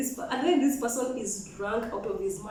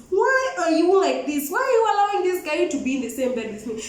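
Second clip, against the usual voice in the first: exasperated confrontation; 6 semitones higher, 4 dB louder than usual. Retold or imitated. Woman confronting friend about guy in bed, exasperated confrontation. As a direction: build the retold complaint into heated disbelief.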